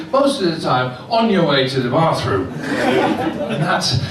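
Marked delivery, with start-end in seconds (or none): none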